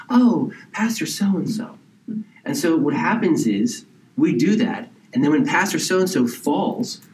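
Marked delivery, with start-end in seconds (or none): 0.1-1.8 higher, swooning drawn-out gush; gushing admirer. Retold or imitated. A congregant gushing over the pastor, swooning. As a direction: swoon over the name, slowly.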